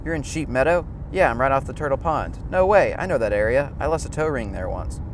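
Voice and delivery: ordinary talk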